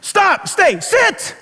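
high, sharp repeated dog commands; strong commands. Retold or imitated. Retelling the rescue to the congregation, high sharp commands. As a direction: bark the commands to freeze her. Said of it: "Strong words".

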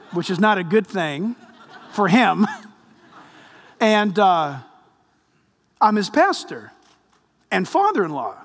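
usual speaking voice

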